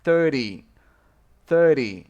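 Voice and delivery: Thirty with a quick light ending; stress on thir. Shown as a demonstration. Teacher contrasting thirty with thirteen, quick ending. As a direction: say Thirty with the stress up front and a fast final syllable. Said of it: "eu falo bem rápido no final".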